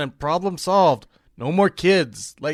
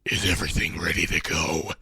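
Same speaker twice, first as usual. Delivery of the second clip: deep, soft menacing impression; mock general; 12 semitones lower, 4 dB quieter than usual. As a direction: impersonate a grim commanding general. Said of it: "the general walks into".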